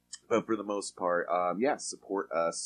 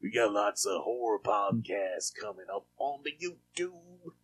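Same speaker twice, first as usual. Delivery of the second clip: put-on slow, higher old man voice; old man Bill Cosby voice; 4 semitones higher, 4 dB louder than usual. Shown as a demonstration. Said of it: "I'm saying it like I'm, uh, old man Bill Cosby".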